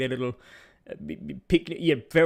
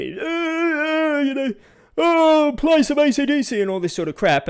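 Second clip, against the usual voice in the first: much higher, louder slurred yob voice; drunk heckler; 13 semitones higher, 6 dB louder than usual. Retold or imitated. Narrator mimicking drunk tourists demanding ACDC from pianist, loud high heckle. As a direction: bellow requests like a drunk bogan.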